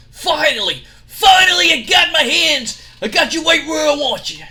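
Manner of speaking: high, loud triumphant yell; gloating shout. Acted. Gloating wrestlers yelling at trapped rivals, loud triumph. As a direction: crow it like you've finally trapped him.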